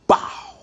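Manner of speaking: sudden punchy vocal gunshot; short, explosive "bow"; imitated gunshot. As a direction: mimic a single gun blast by mouth.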